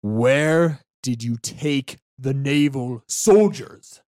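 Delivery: loud, slow, weighted demand; heavy, deliberate interrogator voice; glaring interrogation. Acted. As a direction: question the prisoner slowly and forcefully. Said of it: "glares at me and goes".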